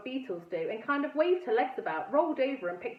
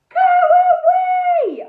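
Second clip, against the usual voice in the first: very loud, high, slow yell; shouted; 15 dB louder, much slower than usual. A narrator's reading. Girl yelling at the visitors, full shout. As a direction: yell it down from the balcony. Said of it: "I shouted as loud as I could".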